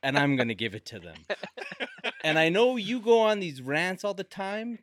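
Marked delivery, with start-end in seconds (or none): 0.0-1.2 quoting cohost. Retold or imitated. Retelling a cohost's vow to the podcast, mock-menacing. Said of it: "Tim was like, he was like".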